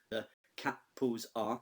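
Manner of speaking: slow, syllables of The capels are counted out; counting syllables. Shown as a demonstration. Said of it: "that's another four syllables".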